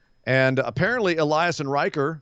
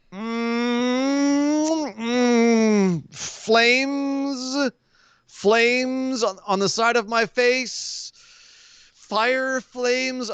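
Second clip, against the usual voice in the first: high, hammed-up whimper of burning; mock burning pain; 10 semitones higher, much slower than usual. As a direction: whine like your face is on fire. Mock pain, flames on face.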